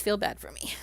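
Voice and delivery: mock-pitiful whisper of feel bad for me. Shown as a demonstration. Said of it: "whispered repeat".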